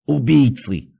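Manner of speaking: furious high-pitched threat; hoarse shout. A narrator's reading. Patient threatens the guard behind the door, shouting hoarsely. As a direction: rage at your captors with a strained cry. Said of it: "крикнул он сиплым голосом".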